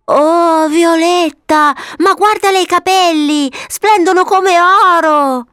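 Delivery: very high, drawn-out wonder; amazed little boy. Acted. Papavero showing Violetta the golden hair, amazed. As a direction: stretch the words in childlike awe.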